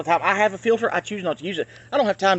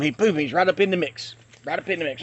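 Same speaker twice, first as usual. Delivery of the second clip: normal talking voice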